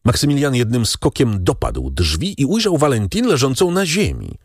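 usual speaking voice

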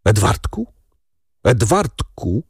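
choked, halting call of a name. A narrator's reading. Father calls his son beside his dead wife, choking it out. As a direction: force the name out through a tight throat. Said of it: "wykrztusił".

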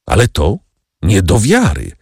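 usual speaking voice

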